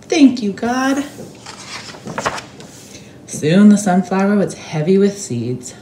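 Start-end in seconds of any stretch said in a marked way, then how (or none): 0.0-1.0 high, loud squeaky joy; joyful cry. A narrator's reading. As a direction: cry out in delight. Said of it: "cried Mortimer".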